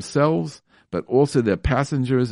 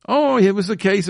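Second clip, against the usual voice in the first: mock excuse; 8 semitones higher, 4 dB louder than usual. Mocking excuse-maker.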